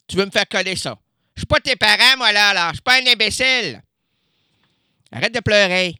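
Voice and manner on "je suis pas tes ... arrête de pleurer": scolding. Acted. Scolding a teen.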